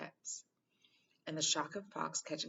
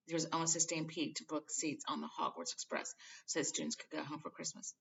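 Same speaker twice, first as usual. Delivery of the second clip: usual speaking voice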